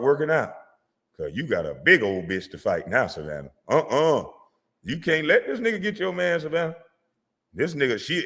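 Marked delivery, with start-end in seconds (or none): none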